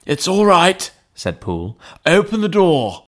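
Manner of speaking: put-on a frightened old butler; anxious butler. A narrator's reading. Butler Poole in story.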